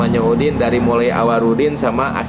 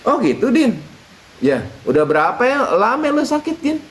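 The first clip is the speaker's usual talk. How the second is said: kiai voice; 10 semitones higher than usual. Acted. Kiai asks Udin.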